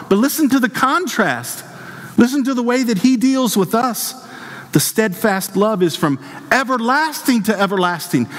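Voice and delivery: high, urgent pulpit voice; impassioned preaching. Real, not acted. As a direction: preach it with rising fervor, pitched well up. Preaches at raised pitch.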